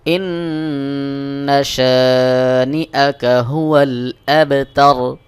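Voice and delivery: slow, drawn-out tajweed chant; ধীর তিলাওয়াত; slow Quran recitation. Shown as a demonstration. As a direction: recite each word long and measured. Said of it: "সহিহ উচ্চারণ হবে".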